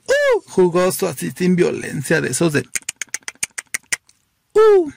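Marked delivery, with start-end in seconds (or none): 0.1-0.4 sudden squeal far higher; a campy little shriek; flamboyant high squeal. Retold or imitated. Teller mimicking the flamboyant guy, high camp squeal. 4.5-4.9 loud, much higher campy squeal. Retold or imitated. Teller mimicking the flamboyant guy, loud high squeal.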